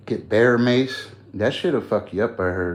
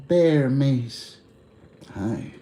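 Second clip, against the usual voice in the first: ordinary talk, nothing marked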